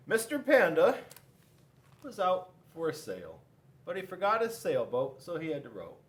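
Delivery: ordinary delivery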